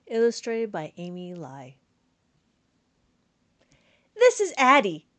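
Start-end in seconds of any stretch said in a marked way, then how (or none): none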